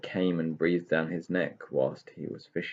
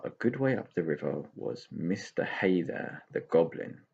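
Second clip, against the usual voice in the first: ordinary delivery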